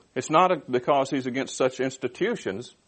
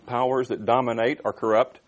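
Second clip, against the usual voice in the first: ordinary delivery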